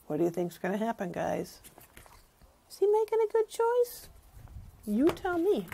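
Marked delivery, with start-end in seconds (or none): none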